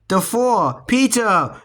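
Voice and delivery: high yell of Peter!. A narrator's reading. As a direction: parents shouting their son's name. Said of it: "yelled Mom and Dad".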